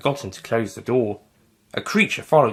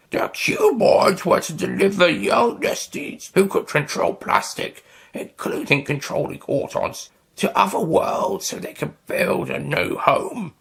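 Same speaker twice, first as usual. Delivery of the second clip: slow, high whispery alien; squid alien voice; 8 semitones higher than usual. Acted. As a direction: creep through it like a squid creature. Squid Nestine voice.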